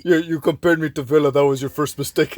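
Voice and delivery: cocky taunt in a borrowed voice; mock Zlatan boast. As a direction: play the arrogant star, half in jest.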